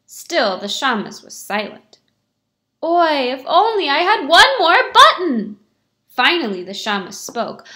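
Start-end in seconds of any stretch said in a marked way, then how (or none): 2.8-5.6 louder, higher theatrical wail; milk it for the caretaker; big loud lament. Acted. Beggar wailing at the shamas a third time, hammed-up.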